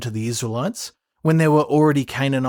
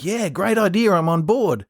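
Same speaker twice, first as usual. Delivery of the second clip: lightly voiced eager yes, pitched up; agreeable king; 6 semitones higher than usual. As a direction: agree readily as an ally, a little higher. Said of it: "he's like".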